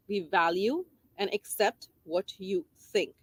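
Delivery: slowed down, each word weighed; slow emphatic repeat. Shown as a demonstration. Teacher repeating the message to parents, slowly. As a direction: let it sink in, slowly. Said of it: "Message number three".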